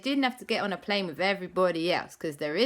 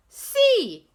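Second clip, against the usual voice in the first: high eager guess; 9 dB louder than usual. Acted. Child guessing in I Spy.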